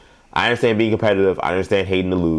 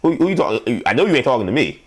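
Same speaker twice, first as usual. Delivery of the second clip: street comeback; 7 semitones higher than usual. Pretend. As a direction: throw it back, indignant. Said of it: "I'd say".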